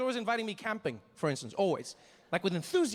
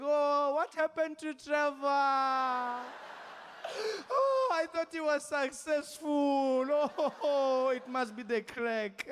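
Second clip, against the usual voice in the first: high, slow, wailing grandmother; distraught grandmother; 6 semitones higher, slower than usual. Retold or imitated. Grandmother wailing over her grandson. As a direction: grieve like a grandma who thinks he failed. Grandmother seeing him camping.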